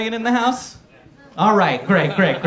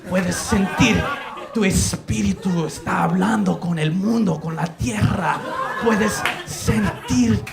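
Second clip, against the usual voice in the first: mock shaman incantation. Sons guide us through ayahuasca, mock mystical intoning. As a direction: intone it like a shaman guiding a trip. Healers intone over the drinkers.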